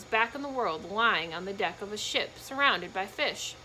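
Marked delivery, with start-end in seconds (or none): none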